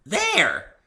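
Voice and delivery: high, loud and eager; excited. Acted. Pinkie points excitedly at the cloud, high and eager. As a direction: point it out, bursting with glee.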